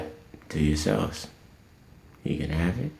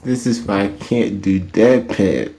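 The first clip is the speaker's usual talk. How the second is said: normal talking voice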